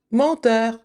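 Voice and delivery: ordinary talk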